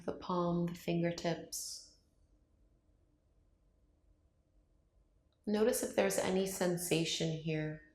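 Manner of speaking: calm meditation voice with long, unhurried pauses; guided meditation. Acted. Yoga teacher guiding viewers to open up to love, calm meditation voice. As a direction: ease them through each breath, leaving silence between phrases.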